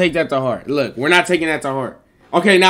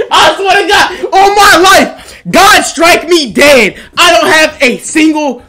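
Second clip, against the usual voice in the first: desperate swearing; 14 semitones higher, 11 dB louder than usual. Real, not acted. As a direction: beg them to believe you, stretched out. Pleading innocence to chat.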